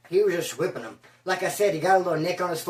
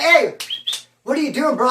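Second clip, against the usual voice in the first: loud hey; 6 semitones higher, 4 dB louder than usual. Retold or imitated. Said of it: "I was like".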